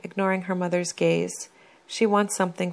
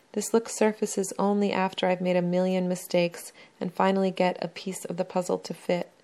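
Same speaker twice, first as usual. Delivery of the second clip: ordinary delivery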